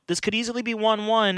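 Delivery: ordinary talk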